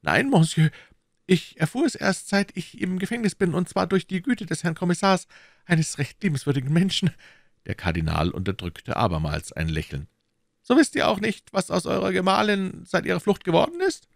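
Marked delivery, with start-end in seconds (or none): 0.0-7.1 higher-pitched, deferential prisoner voice; anxious prisoner. Acted. Mercer answering the cardinal in prison, anxious and deferential. 10.6-14.1 high, probing interrogator voice; questioning cardinal. Acted. Cardinal questioning the prisoner about his wife, probing.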